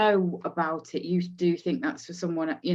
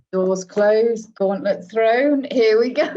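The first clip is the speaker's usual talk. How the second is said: louder, slow declaration of a challenge accepted; mock battle cry; 4 semitones higher, 8 dB louder, slower than usual. Real, not acted. To people who say they don't like beer, a loud playful challenge. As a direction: throw down the gauntlet with relish.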